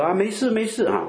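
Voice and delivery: high, falsely cheerful brush-off; mock breezy denial. Preacher mimics a hypocrite hiding pain from God, falsely cheerful. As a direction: pretend all is fine, lightly. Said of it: "不像一些人假冒为善啊".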